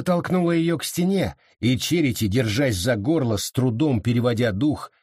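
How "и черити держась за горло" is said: ordinary delivery, nothing marked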